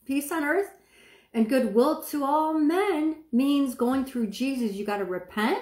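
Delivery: normal talking voice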